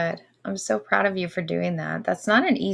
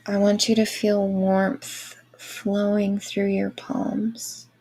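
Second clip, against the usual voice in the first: airy breathy mystical healer. Acted. Guided Reiki meditation.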